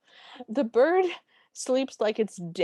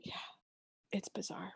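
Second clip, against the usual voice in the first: softer, whispered aside; 15 dB quieter than usual. Real, not acted. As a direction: breathe it as a secret aside. Aside to co-host.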